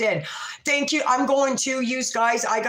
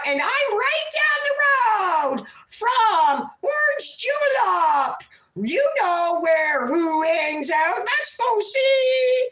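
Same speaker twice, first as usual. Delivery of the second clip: high, loud, slow mocking sing-song; a slow, high, shouted parody of a rival streamer; mocking impression; 11 semitones higher, 3 dB louder, slower than usual. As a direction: ham up her words like a shrill caricature.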